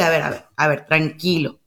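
a hint of a soothing parent; calming parent. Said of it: "en lugar de decirle". (padre calmando, voz más grave y suave)

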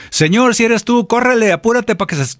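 high, hurried mock plea for rescue; urgent plea. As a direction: beg urgently as anyone in danger would. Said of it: "Cualquiera le hubiera dicho".

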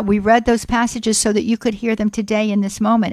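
normal talking voice

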